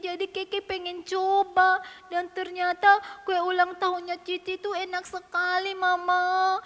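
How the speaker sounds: put-on high, slow, drawn-out childish whine; whimpering apology. Keke confessing to Mama and begging forgiveness, whimpering. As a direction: confess like a sorry little child.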